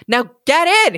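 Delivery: impatient goblin shout. A narrator's reading. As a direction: goblin losing patience with the girl. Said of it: "he shouted".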